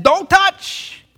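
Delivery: high, loud prohibition; stern shouted command. Acted. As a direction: bellow it as an angry warning. God's command acted out in anger.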